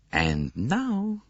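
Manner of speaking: a lightly voiced Poirot, amused; playful detective with a knowing twinkle; Poirot, twinkling. A narrator's reading. Poirot to the train director, a hint of twinkling mischief. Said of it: "said Poirot with a twinkle in his eye".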